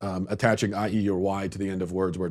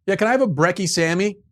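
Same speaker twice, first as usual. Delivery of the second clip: mock Australian customer; 8 semitones higher than usual. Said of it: "Is that what you guys say?".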